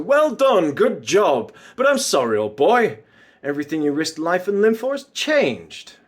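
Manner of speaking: villain sarcastically quoting the bosses; mocking praise. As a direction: parody a superior's hearty praise. Villain mockingly quotes praise from superiors.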